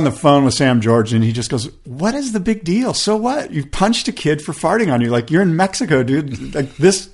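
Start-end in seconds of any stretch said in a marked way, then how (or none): 1.8-7.1 high, lightly mocking disbelief, what's the big deal; incredulous editor. Editor on the phone shrugging off the punch, high incredulous tone. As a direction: wave it off as if everyone's overreacting. Editor dismisses the fuss, retold.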